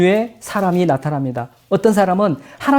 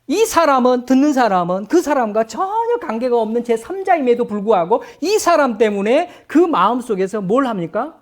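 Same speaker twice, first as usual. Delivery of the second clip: normal talking voice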